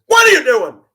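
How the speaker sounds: sudden high, loud shout; startling shout. Retold or imitated. Bus driver behind a yelling kid, sudden loud shout. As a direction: burst out to make the kid jump.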